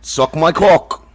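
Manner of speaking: put-on drawn-out comic Australian accent. Answering co-host's request for the accent, Australian accent. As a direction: do a slow, cheeky Australian. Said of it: "you gotta do it in an Australian accent".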